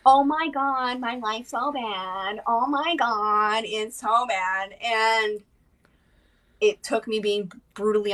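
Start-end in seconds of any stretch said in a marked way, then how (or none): 0.1-4.7 slow, drawn-out mock lament; woe-is-me wallowing. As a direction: wallow in self-pity, exaggerated and dragging. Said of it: "stop existing in the woe is me".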